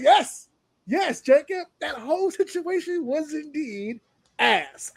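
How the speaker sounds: high, slow, delighted mock cheer; gleeful agreement. Podcaster gleefully agreeing with Jacob, high and delighted. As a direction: cheer him on, savoring every word.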